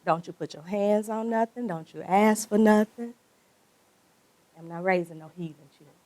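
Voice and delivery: lightly voiced mother, low and hushed to start; mama's warning. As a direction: quietly lay down the law as a mother. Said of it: "my mama always told me as a child".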